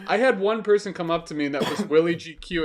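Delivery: normal talking voice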